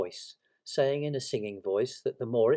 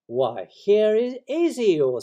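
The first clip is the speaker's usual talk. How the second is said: slow, loud, high surprised greeting; welcoming Pooh; 11 semitones higher, 11 dB louder, much slower than usual. Acted. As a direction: hail a friend with delight. Pooh greets Eeyore with welcoming noises.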